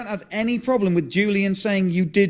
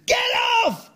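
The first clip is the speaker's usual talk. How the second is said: retold scream. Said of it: "I'm screaming at him".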